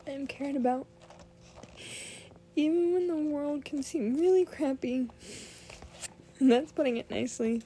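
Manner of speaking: fighting back tears while talking; holding back tears. Real, not acted. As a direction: voice breaking, high and loud. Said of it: "I'm trying not to cry right now".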